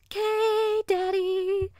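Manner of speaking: put-on high, loud, drawn-out child's squeal of agreement; excited little girl. Daughter answering daddy before racing off to play, excited. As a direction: chirp it up high like an eager child.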